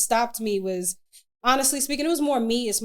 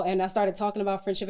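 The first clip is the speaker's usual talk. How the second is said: ordinary talk, nothing marked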